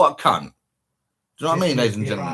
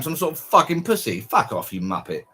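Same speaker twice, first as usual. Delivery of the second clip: normal talking voice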